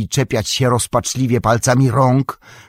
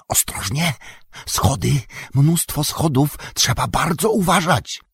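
whispering; 5 semitones higher than usual. A narrator's reading. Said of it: "odwrócił się szepcząc".

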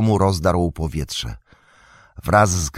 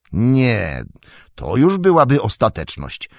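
sudden, higher, louder retort; flaring up; 5 semitones higher, 4 dB louder than usual. A narrator's reading. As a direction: Frodo bristling at waiting till dawn. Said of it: "uniósł się nagle Frodo". (nagle uniesiony, wyższy głos)

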